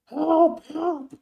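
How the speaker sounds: high, loud mocking shout of help; mock cries for help. Commentator imitating the woman's screams for help, high mock yell. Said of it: "your screaming help".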